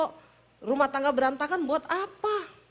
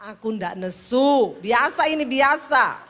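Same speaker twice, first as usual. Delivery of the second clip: loud, emphatic denial; high-toned protest; 6 dB louder, slower than usual. Retold or imitated. Wife denying to her husband that she is angry, loud protest. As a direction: insist loudly that this is normal. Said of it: "nadamu tuh tinggi".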